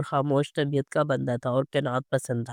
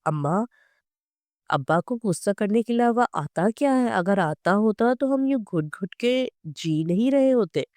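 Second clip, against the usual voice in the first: higher, bitter angry outcry; roaring outburst; 5 semitones higher than usual. A narrator's reading. Daughter lashing out at mother about father, angry outcry. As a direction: lash out at your mother. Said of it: "Nasreen धाड़ी".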